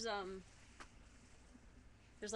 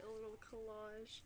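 ordinary delivery

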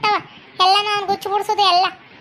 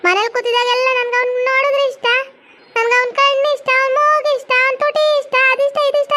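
higher mock lovesick gushing; mocking the daughter; 4 semitones higher than usual. Mother mimicking her daughter gushing about the boy, mock. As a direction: ape a besotted girl listing his charms. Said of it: "ಅಂತ ಬಾಯ್ ಒಯ್ಕಂತೀರಿ".

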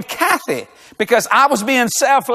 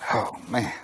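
tired groan; 13 semitones lower, 13 dB quieter than usual. Retold or imitated. Exhausted after last service.

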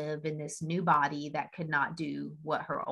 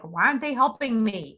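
louder, higher mock-annoyed whine; annoyed complaint; 5 semitones higher, 7 dB louder than usual. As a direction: grumble at the monks, acted. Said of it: "getting annoyed with the monks".

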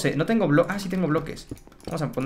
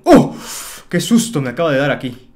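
startled cry; 2 semitones lower, 6 dB louder than usual. Real, not acted. Sudden scare in the game.

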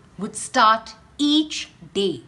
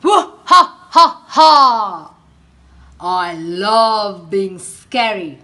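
loud booming evil laugh; villain laugh; 10 dB louder than usual. Acted. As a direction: cackle like a proud monster. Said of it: "laughed Alan".

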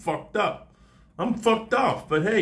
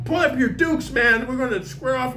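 mock fight challenge; 10 semitones higher than usual.

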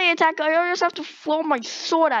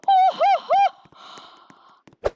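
excited whooping; 5 dB louder, much slower than usual. Real, not acted. Celebrating again in game.

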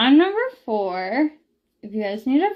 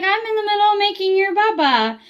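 put-on higher, lilting voice for a toddler; sing-song baby talk; 8 semitones higher, faster than usual. Mom explaining to her toddler, in higher sing-song baby talk. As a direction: coo it like you're talking to a baby.

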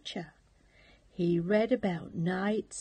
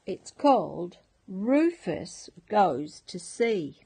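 usual speaking voice

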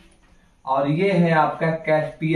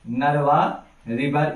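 Narwa river said slowly, word by word; dictating Narwa river at a slow pace; slow dictation; slower than usual. Shown as a demonstration. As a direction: spell it out slowly for the class. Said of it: "क्या नाम है?".

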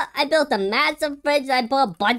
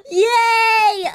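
high gasp then a loud yay; delighted gasp and cheer; thrilled cheer; 10 semitones higher, 4 dB louder than usual. Real, not acted. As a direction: squeal with joy at the score. Gets ten out of ten.

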